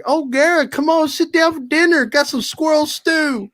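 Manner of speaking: high-pitched imitation of a country mama hollering; tough old gal. Mama calls Garrett to sit down for dinner, high country holler.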